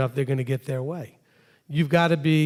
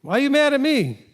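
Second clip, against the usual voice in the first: raised protest to mother; upset protest; 9 semitones higher than usual. Pretend. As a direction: push back at Mom, voice up. Acted quarrel with mother.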